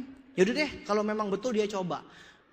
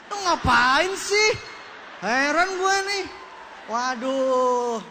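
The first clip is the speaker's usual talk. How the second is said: mock bewilderment; 3 semitones higher, 5 dB louder, much slower than usual. Speaker to lone guest at empty meeting, mock bewilderment. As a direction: stretch it out in loud, comic disbelief. Two lone attendees baffled at each other.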